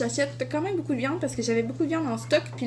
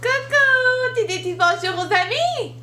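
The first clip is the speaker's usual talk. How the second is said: playful coucou; 10 semitones higher, 4 dB louder, slower than usual. Real, not acted. Mom greets her baby on camera in a high, loud playful voice.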